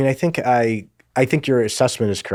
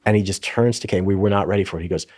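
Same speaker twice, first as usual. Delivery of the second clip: ordinary delivery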